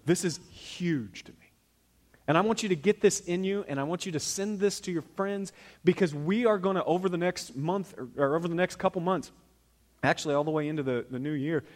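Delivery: ordinary delivery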